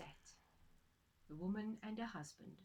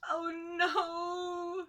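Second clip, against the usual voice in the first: much higher, louder wail; crying wife; 9 semitones higher, 11 dB louder than usual. Acted. Wife with meat stuck to her nose, crying out high. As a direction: wail in dismay. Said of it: "said the woman".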